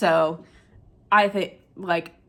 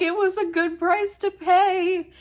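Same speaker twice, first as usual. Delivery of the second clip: gentle reassurance; 11 semitones higher than usual. Retold or imitated. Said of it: "Shanks is like".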